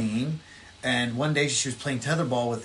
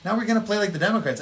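mock Republicans quote; 8 semitones higher than usual. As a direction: squeak it out like a caricature. Republicans voiced deciding to fight.

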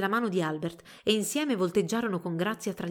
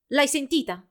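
raised, louder sharp retort; snapping; 6 semitones higher, 5 dB louder than usual. A narrator's reading. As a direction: snap at your rival. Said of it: "scattò Albert".

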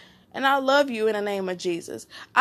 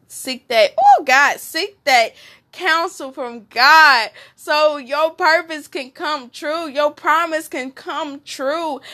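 fervent preaching; 3 semitones higher, 3 dB louder, slower than usual. Acted. Preaching, praying aloud.

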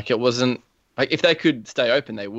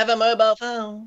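high nerdy voice; nerd voice; 9 semitones higher than usual. Retold or imitated. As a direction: sneer it like a geek. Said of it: "that was my nerd voice".

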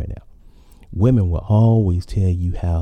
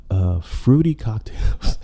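laughing; 4 semitones higher, slower than usual. Real, not acted. Laughs at own topic.

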